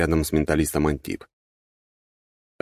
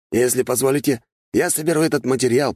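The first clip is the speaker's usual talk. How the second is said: higher, lightly voiced servant; deferential request; 8 semitones higher than usual. A narrator's reading. Grigory asks his master to collect the material, lightly deferential. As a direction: ask permission in a raised voice. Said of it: "вопросительно посмотрев на меня".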